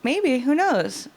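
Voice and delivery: high, teasing dodge; coy. Acted. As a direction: play innocent, sing-song. Coy evasive answer.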